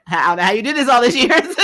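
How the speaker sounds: giggling mock-venting to an old friend; laughing through it. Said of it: "What she's really saying is".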